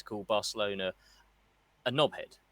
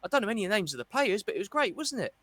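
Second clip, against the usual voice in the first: higher, fast gushing mimic; mock colleague gushing; 6 semitones higher, faster than usual. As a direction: lampoon a workmate who knows no names. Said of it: "people coming up to me".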